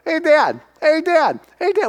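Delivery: put-on high, chirpy kid calling Dad; imitating an eager boy tugging for attention; a child's high repeated call; son pestering dad. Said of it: "Trevor's always".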